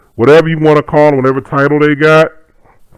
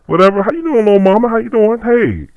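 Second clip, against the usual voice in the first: friendly greeting; 10 semitones higher than usual. Retold or imitated. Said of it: "I say".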